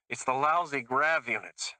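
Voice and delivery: a lightly voiced pleading driver. A narrator's reading. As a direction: beg and grumble at once. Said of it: "in the same pleading voice".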